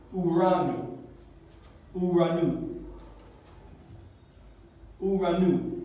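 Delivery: uranu repeated as u-ra-nu with a falling end; model pronunciation. Shown as a demonstration. Teacher modelling a Greek word for students, model pronunciation. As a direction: repeat uranu distinctly, pausing between takes. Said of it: "Así se pronuncia esta palabra".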